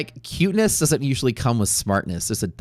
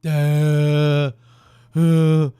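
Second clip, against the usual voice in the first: loud, higher dopey "duh"; dumb dog; 5 semitones higher, 7 dB louder than usual. Retold or imitated. The dim maltipoo reacting to the TV, loud dopey grunt. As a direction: voice a brainless dog. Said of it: "The other dog is like".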